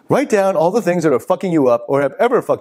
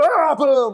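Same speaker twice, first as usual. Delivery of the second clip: mock crash; 12 semitones higher than usual. Nicholson bursting through door.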